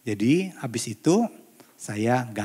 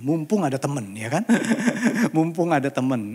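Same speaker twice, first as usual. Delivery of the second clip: ordinary talk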